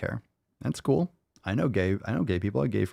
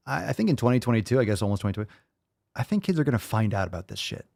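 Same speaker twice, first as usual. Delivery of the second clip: ordinary talk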